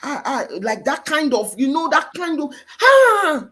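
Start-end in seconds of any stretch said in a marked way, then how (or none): 2.8-3.5 high, loud burst of exasperated shouting; imitated mom's shout. Mom shouting on her children, loud wordless yell. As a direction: mimic a mother yelling at her children. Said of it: "moms shouting on their children".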